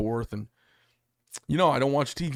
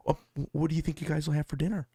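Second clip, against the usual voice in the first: lightly voiced daughter, higher and quick; a hint of your daughter's voice, hurried; imitating daughter; 5 semitones higher, faster than usual. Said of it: "my daughter says to me".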